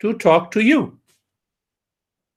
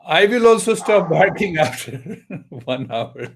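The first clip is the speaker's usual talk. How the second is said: laughing; 5 semitones higher than usual. Real, not acted. Joking, laughing mid-line.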